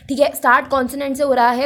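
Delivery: usual speaking voice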